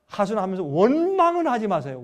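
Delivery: ordinary talk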